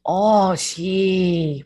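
drawn-out Minnesota vowels; put-on Minnesota accent. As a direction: mimic a Minnesotan listener, stretching each word. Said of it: "they were mimicking my Southern accent".

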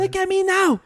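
a light impression of a British fighter; hammed-up British accent; imitating British accent. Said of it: "The British accent?".

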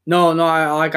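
normal talking voice

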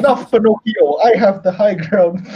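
mock Obi-Wan line. Obi-Wan quote parody to friend.

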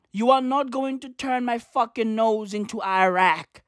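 ordinary talk, nothing marked

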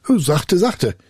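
high, quick interjection; unafraid pupil. A narrator's reading. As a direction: check the spirit lightly. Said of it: "antwortete der Schüler".

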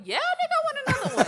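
squeaky high, eager voice; high mock voice. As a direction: go way up, overeager. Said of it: "he was looking at me like".

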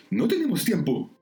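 high, brisk captain's warning; urgent warning. Acted. Shirley telling the crew to abandon the rescue, urgent.

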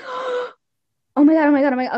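1 mock gasp. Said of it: "At least they're not like".

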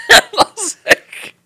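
shrieking high laugh; burst of laughter. Real, not acted. Friend bursts out laughing at the ironic twist, shrieking high. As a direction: let out a huge shrieking laugh.

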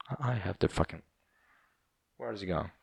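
ordinary talk

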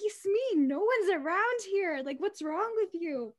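high, exasperated friends; mock exasperation. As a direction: tease her like she's losing it. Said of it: "They're like".

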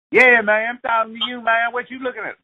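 high, pushy street tough calling out; high-pitched tough hassling a stranger. Retold or imitated. Street tough picking a fight.

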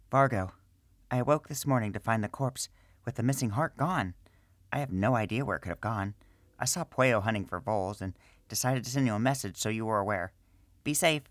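lightly voiced priestess letter; priestess reading letter.

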